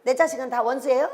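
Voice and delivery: usual speaking voice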